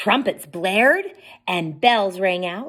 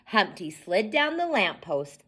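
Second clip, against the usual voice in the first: ordinary delivery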